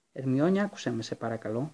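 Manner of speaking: ordinary delivery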